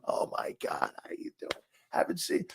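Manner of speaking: put-on breathless whispered old-friend greeting; a friend's greeting, hushed. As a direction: whisper the gushing hello. Virgil greets him, retold.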